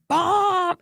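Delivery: high, loud bossy mama; emphatic high-pitched retold yelling; imitated scream. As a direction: holler orders like a mama halting the show. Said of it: "She screamed out".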